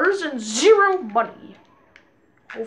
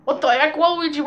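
ordinary talk, nothing marked

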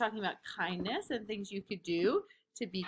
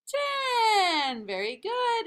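excited cheer; 11 semitones higher, 4 dB louder, slower than usual. Acted. Counting ends in cheer.

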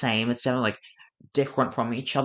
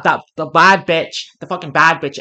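normal talking voice

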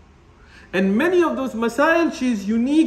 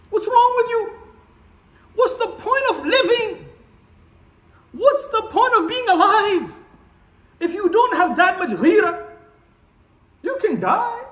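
outraged rant; 10 semitones higher than usual. Real, not acted. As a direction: vent fury at their passivity. Outraged rant at passive Muslims.

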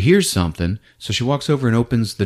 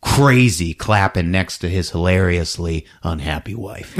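normal talking voice

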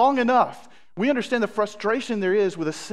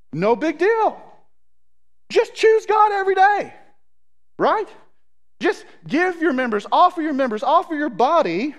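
high, loud, hammed-up cheeriness; exaggerated sing-out, tossing off the sermon; mock breezy ease; 8 semitones higher, 4 dB louder than usual. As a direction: make it sound absurdly easy, as mock Paul. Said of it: "it sounds like this should be easy".

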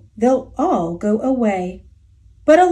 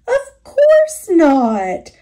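slow, smiling and louder; 6 semitones higher, 5 dB louder, much slower than usual. Acted. Moose welcoming the bug onto his antlers, slow and smiling. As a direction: say it slowly with a big friendly smile. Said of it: "smiled Thidwick".

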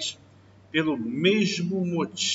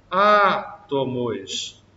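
slow drawn-out word; 4 dB louder, much slower than usual. Shown as a demonstration. Teacher slowly stretches the example word for the class. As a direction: stretch every syllable slowly. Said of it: "teacher drawing out example word".

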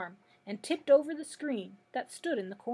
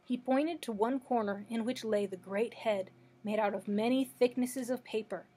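ordinary delivery

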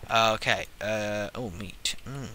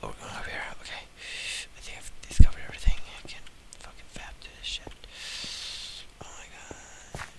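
hushed unvoiced whisper; faster than usual. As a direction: sneak along whispering. Sneaking, whispering in cave.

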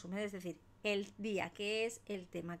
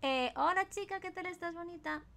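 high, excited singsong; gushing shout-out; 7 semitones higher, 3 dB louder than usual. Real, not acted. Streamer thanks a gifter and greets a viewer, high and gushing.

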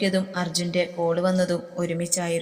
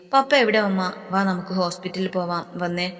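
plain reading voice

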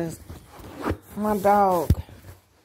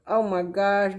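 ordinary talk

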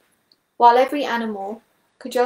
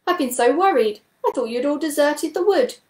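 higher, louder anxious voice; worried Toad; 5 semitones higher, 4 dB louder than usual. A narrator's reading. Toad finds the missing animals, worried. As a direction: pour out the worry to friends. Toad pours out his worry.

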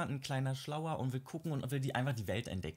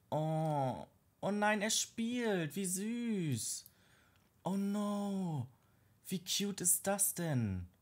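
cooing delight; 8 semitones higher, much slower than usual. Real, not acted. Cooing over toddler playing.

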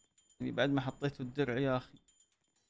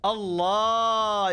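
"الله" stretched very slowly, higher and louder; a slow, rising cheer of "الله"; thrilled drawn-out wow; 6 semitones higher, 5 dB louder, much slower than usual. Real, not acted. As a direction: cry out in delight at the play. Admiring a slick move.

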